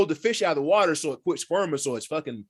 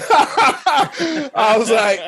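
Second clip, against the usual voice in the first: slow, loud laughing words; laughing hard between quotes; laughing loudly; 9 semitones higher, 9 dB louder, much slower than usual. Real, not acted. Big laugh.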